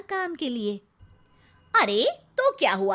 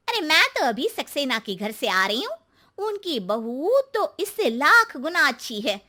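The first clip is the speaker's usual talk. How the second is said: usual speaking voice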